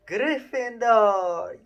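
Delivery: long, loud, drawn-out shout; hat shouting. Acted. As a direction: belt the house name across the hall. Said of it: "had shouted".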